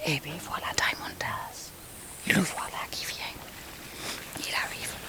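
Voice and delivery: hushed whisper as the dog comes in; soft whispered running commentary. Real, not acted. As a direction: whisper so as not to disturb.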